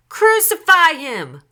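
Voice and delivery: high, slow, loud chant; drawn-out high yell; crowd shout. A narrator's reading. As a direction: shout it like a mob demanding death. Whole crowd answers Pilate.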